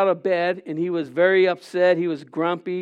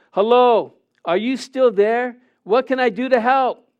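louder and higher, calling into the rain; 5 semitones higher, 4 dB louder than usual. A narrator's reading. Husband calling into the stormy yard, calling out loud. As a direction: call out across the yard. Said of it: "so he called out".

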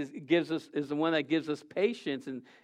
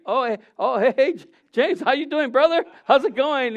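much higher, louder, over-cheerful hello; flustered cover-up greeting; 7 semitones higher, 5 dB louder than usual. Retold or imitated. Pastor caught swearing greets the worship leader, high and loud. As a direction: cover the slip with a bright fake greeting. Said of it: "and I'm like".

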